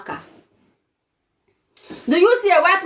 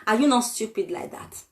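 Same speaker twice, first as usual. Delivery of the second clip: normal talking voice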